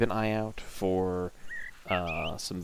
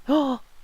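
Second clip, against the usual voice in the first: delighted gasp; 5 dB louder than usual. Real, not acted. Sudden gasp at pet photos.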